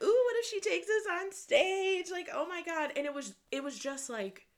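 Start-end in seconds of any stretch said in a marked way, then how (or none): 0.0-2.9 high, loud playful fantasizing; joking excitement. Retold or imitated. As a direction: squeal a wishful joke to a friend. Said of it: "we jokingly were like".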